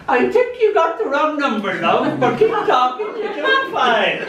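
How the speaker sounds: high, amused voice through laughter; a stranger chuckling down the phone; amused caller, laughing as they speak. Acted. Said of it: "sudden laughter on the line".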